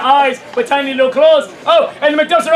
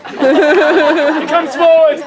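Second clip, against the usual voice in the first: usual speaking voice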